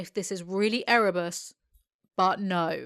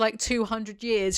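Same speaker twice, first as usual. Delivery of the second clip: ordinary talk, nothing marked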